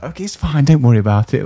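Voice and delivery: high, soothing New Zealand lilt. Retold or imitated. Barmaid reassuring customers over kitchen shouting, imitated New Zealand lilt. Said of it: "in that New Zealand, like, lilt".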